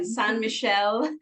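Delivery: "Saint Michel" said the French way, slowly; French-style "san mee-SHEL"; French pronunciation. Shown as a demonstration. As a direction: try a careful French accent on "Saint Michel". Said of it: "here we go again".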